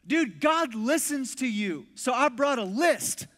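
high, loud and drawn-out excitement; slow down and punch it with excitement; excited friend. Retold or imitated. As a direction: imitate an amazed, eager buddy. Friend excitedly brings prayer requests.

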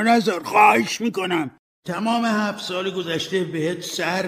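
high, loud urgent plea; pleading ghost warning. Acted. Marley urges Scrooge not to end like him, high and pleading. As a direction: beg him to heed the warning.

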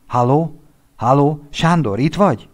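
anxious friend shouting into a phone; calling down the line. Acted. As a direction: call out for him on the line.

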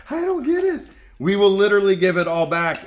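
high, loud and fast, can't believe it; excited disbelief. Real, not acted. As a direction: blurt it out in amazed disbelief. Stunned find in storage unit.

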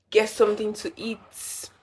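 ordinary talk, nothing marked

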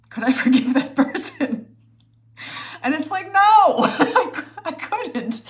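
breaking into high laughter mid-question; laughing. Real, not acted. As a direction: laugh at how impossible it is. Absurd question answered by herself.

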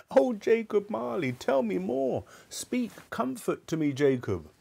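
high-pitched, fading plea; imploring. Acted. Scrooge begging Marley for comfort, imploring. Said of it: "he said imploringly".

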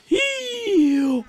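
long, high-pitched gasp; shocked gasp. Acted. Headman finding the well empty, shocked gasp. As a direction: suck in a theatrical gasp.

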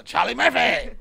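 high, loud name shout; loud call-out. Acted. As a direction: holler the name out. Calls out the brother's name.

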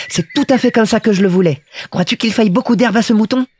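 put-on high, pleased little-boy voice; be a small child getting exactly what he wanted; delighted Petit Prince. A narrator's reading. Little prince gets his boxed sheep, high and delighted.

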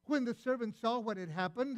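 ordinary delivery

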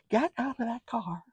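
high but hushed acted command. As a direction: repeat the order with the volume dropped. Repeats the order softer, after laugh.

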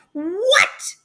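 loud, high yelp of disbelief; startled cry. Acted. Mom seeing the dinosaur, crying out in shock. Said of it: "cried my mom".

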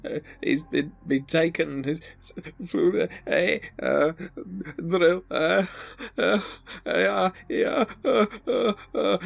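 high-pitched, distraught victim breaking down; bursting into tears. Acted. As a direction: let the words collapse into sobs. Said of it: "And she bursts into tears".

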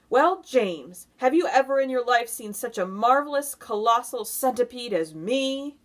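loud, high, eager showing off; boastful centipede. A narrator's reading. Centipede fishing for admiration from James, boastful and loud. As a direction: brag grandly and briskly.

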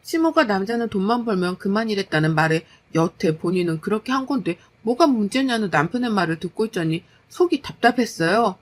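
ordinary delivery, nothing marked

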